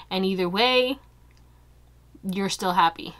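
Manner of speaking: ordinary delivery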